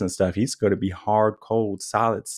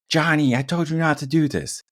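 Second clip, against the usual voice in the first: higher, sharp scolding yell; yelling parent; 7 semitones higher than usual. Retold or imitated. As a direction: scold the kid in a raised voice. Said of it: "So you're yelling and you're screaming".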